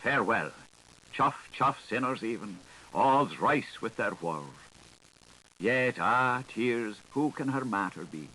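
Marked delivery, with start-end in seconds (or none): none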